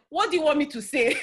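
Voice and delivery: put-on loud, blunt Nigerian accent. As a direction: fire it back in a strong Nigerian accent. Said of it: "most Nigerian accent".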